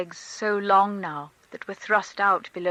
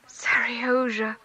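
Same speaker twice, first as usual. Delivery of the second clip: breathless, louder rush of love; breathing hard; 5 semitones higher, 8 dB louder, slower than usual. A narrator's reading. Mother reunited with her sleeping son, breathless and overwhelmed. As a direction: gasp it out, overcome at seeing him. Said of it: "breathing hard".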